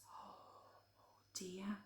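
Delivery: unvoiced hushed oh dear; whisper. Reader to child after scary page, whisper. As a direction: breathe it out in mock fright. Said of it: "reader whispers aside".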